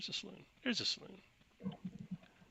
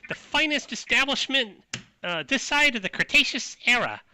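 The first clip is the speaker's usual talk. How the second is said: loud New York saloon hostess; high-pitched New York accent; 6 dB louder than usual. Acted. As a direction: announce the place in high, brassy New York. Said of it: "She's like".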